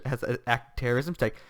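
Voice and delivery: usual speaking voice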